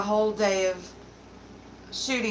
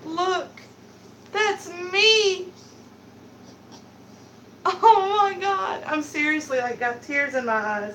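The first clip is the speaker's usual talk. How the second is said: high, overwhelmed voice on the verge of crying; moved to tears; 9 semitones higher than usual. Real, not acted. Streamer touched by a cute picture of herself, moved to tears. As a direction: gush tearfully, pitched way up. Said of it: "I'm gonna cry".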